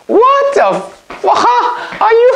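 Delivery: very high, stretched disbelieving cry; shocked outburst. Real, not acted. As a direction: shriek in disbelief, then laugh.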